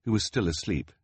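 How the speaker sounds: ordinary delivery, nothing marked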